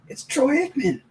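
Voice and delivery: high, awed fanboy squeal; thrilled fan spotting his idol; starstruck. Retold or imitated. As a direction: gasp out the star's name in disbelief. Spotting Troy Aikman in Vegas.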